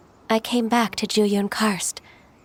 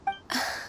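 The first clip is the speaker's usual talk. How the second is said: resigned sigh; 10 dB quieter than usual. Acted. Resigned over being replaced.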